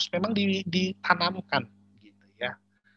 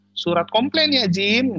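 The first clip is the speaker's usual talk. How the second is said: raised, loud mock boss's order; boss giving orders; 6 semitones higher, 8 dB louder, slower than usual. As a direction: call out an instruction as the boss. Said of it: "bos saya bilang begini". (menirukan bos memberi perintah)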